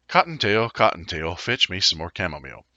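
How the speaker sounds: ordinary delivery, nothing marked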